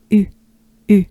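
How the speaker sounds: U with lips rounded, nearly closed; U as "ee" through rounded lips; droopy French U. Shown as a demonstration. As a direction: drop everything into a French U. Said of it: "be a droopy".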